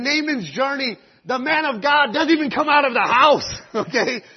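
loud, high, building to a shout; full-force preaching. Real, not acted. Preacher stressing the need for men of God, impassioned shouting. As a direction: preach it at the top of your voice.

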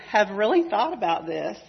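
ordinary delivery

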